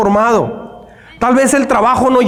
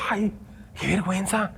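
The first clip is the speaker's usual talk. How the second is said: a much softer, embarrassed aside; embarrassed hush; 15 dB quieter than usual. Retold or imitated. Watching a crying woman sing at the gym, a hushed embarrassed aside. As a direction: murmur it as if nobody should hear.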